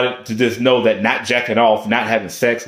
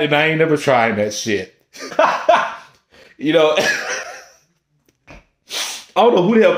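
laughing; 2 semitones higher than usual. Real, not acted.

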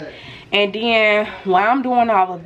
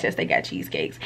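whisper; 7 dB quieter than usual. Real, not acted. Unvoiced, very low aside.

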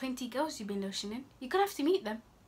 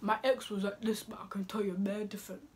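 hushed imitation of a date; low, whispery mock quote; mock confiding; 4 semitones lower, 4 dB quieter than usual. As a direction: mimic a sweet-talker, half whispered. Mimics a date discussing his ex.

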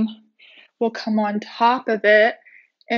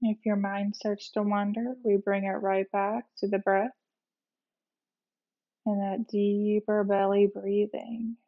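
guided meditation; 4 dB quieter than usual. Real, not acted. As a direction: ease them gently into rest, unhurried. Leads a guided relaxation meditation.

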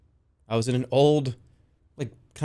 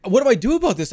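higher, louder mock fluster; freaked out; 7 semitones higher, 5 dB louder, faster than usual. Driver retelling the moment among the tigers, freaked out. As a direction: act out freaking out. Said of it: "I kind of freaked out".

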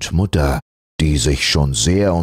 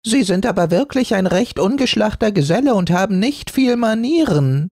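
high, fast and sharp; an old spinster ribbon sniping; pointed old-maid voice; faster than usual. Acted. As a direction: lilac ribbon rebuking the fur. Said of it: "ziemlich spitz".

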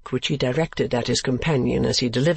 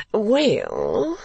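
put-on foppish young lord; 8 semitones higher than usual. A narrator's reading. Lord Verisopht voiced.

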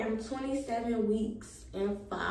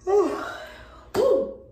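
big tired huff, much higher and louder; tired exhale; 9 semitones higher, 8 dB louder than usual. Real, not acted. Pregnant vlogger blowing out a tired breath to viewers, winded. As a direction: puff it out, worn out. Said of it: "I'm tired".

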